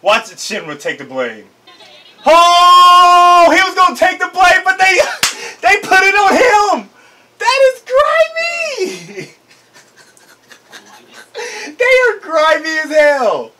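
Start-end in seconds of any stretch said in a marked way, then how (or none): 2.2-9.3 much higher, louder indignant laughing; outraged laughing rant. Real, not acted. 11.7-13.5 higher, slow words through laughter; laughing. Real, not acted. Still laughing at scene.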